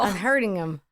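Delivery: hurting said with a dropped G, country style; country accent. Shown as a demonstration. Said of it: "it's my country accent".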